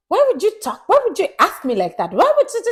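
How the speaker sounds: high, loud mock outrage; indignant mimicry. Imitating partner's reaction to being asked, indignant. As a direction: squawk the repeated question like an affronted partner.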